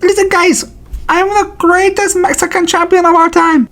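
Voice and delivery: very high-pitched fighter impression; squeaky, boastful champion impersonation; Brandon Moreno. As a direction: pitch it way up and proclaim it. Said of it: "We got Brandon Moreno".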